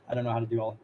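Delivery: ordinary talk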